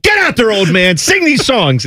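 high-pitched mock ordering about; pushy handler imitation. Host acting a handler pushing an old singer onstage, mock bossy. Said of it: "being shoved out there like".

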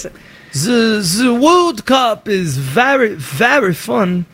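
slow, hammed-up French accent; badly faked French accent, drawn out. Shown as a demonstration. Host demonstrating France for his colleague, mock French accent. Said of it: "Like France. Be like".

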